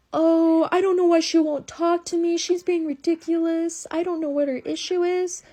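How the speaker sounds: high, sweet-sounding innocent voice; innocent act. Retold or imitated. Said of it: "he immediately changed as soon as he opened the door".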